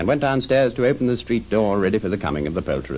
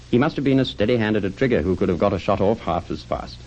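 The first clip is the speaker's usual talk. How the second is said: usual speaking voice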